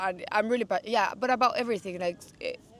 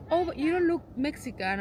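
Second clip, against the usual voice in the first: higher voice imitating a surprised stranger; mock surprised stranger; 8 semitones higher than usual. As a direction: ham up the stranger's surprise a little. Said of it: "And I'm like".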